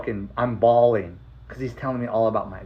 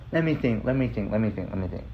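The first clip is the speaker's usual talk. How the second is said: softer, fading repetition; retold absent-minded pondering; thinking to himself; 3 semitones lower, 6 dB quieter than usual. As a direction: trail down quieter as you search your memory.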